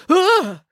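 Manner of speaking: sharp high startled cry; frightened yelp. A narrator's reading. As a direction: jump out of your skin with a yelp. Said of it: "Dad jumped with fright".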